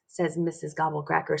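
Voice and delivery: ordinary delivery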